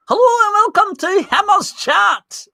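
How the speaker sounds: a high, slow, hammed-up Scottish host voice; mock Scottish accent. Host greets chat in a lightly put-on, high mock Scottish voice. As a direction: put on a silly Scottish voice for the intro. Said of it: "Maybe I would just have to do that".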